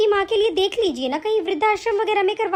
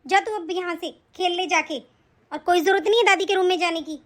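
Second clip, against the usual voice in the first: ordinary talk, nothing marked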